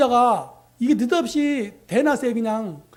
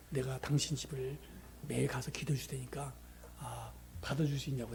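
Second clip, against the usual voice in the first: gentle offer; 10 semitones lower, 14 dB quieter than usual. Retold or imitated. Missionary softly offering to pray at the man's home every day. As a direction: offer it tenderly and quietly.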